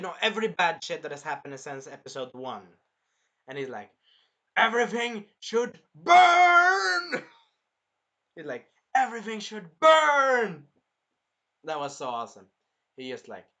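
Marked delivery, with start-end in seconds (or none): 4.6-7.2 very high, very loud drawn-out scream; full-blown raging cry, stretched slow; angry scream. Retold or imitated. Hero remembering every wrong, screaming in fury. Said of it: "he's, like, angry as fuck". 8.9-10.6 high, loud raging yell with a long final word; angry scream. Retold or imitated. Retelling the angry hero, furious yell. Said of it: "he's, like, angry as fuck".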